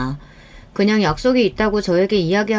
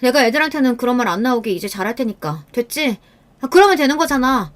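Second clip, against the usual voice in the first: higher-pitched, exasperated voice; irritated brush-off; 5 semitones higher than usual. Acted. Wife telling husband to leave her alone, irritated. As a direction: insist crossly to end the discussion. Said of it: "짜증과 화는 점점 늘어만".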